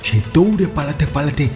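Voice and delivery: usual speaking voice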